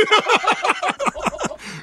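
high burst of laughter. Real, not acted. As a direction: laugh in delight. Laughing at own story. (大笑い)

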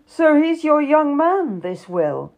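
amused, teasing disbelief; mocking. A narrator's reading. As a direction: poke fun at the idea. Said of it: "a tone that suggested that this was a laughable idea".

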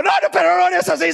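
high, quick comic panic; mock alarm. Young person begging the pastor to stop praying, mock panic. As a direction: plead hurriedly in exaggerated alarm. Youth protests prayer again.

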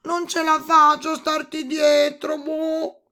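high, loud croaky complaint; toad shouting. A narrator's reading. Toad unable to keep up with princess, high loud cry. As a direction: cry out as a toad left behind. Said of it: "gridò".

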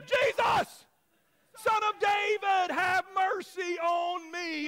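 high, full-voiced shout, drawn out at the end. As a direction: belt the plea out in defiance. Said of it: "say it a little bit louder".

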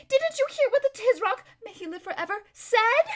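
very high, loud alarmed question; panicked protest. Acted. Lazareline reminding her friend of the Tisroc's words, high and panicked.

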